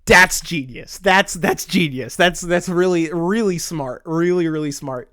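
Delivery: loud, awed repeated praise; amazed. Retold or imitated. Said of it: "I remember sitting there like".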